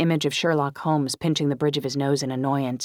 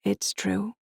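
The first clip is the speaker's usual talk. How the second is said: higher, slightly roughened voice; extra rough; 6 semitones higher than usual. A narrator's reading. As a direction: answer with a touch of roughness. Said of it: "my voice sounding extra rough".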